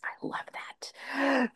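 breathy whisper, slow and hushed. Real, not acted. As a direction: breathe it out in awe. Host reacting to a guest's idea.

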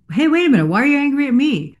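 high-pitched indignant objection; confronting challenge. Acted. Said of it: "when you're not saying".

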